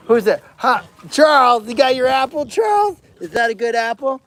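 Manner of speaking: high, singled-out questions to an animal; pet-talk. Real, not acted. Keeper checks Charles got his apple, high pet-talk.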